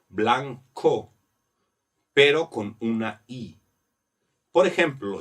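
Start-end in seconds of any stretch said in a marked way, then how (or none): 0.1-1.1 "blanco" split into blan-co, with a k sound; hard co. Shown as a demonstration. Teacher showing the sound to keep, hard co in "blanco". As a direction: separate the final co of "blanco". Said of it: "Tengo que mantener esa co".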